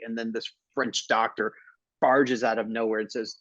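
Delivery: usual speaking voice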